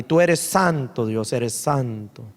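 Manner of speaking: usual speaking voice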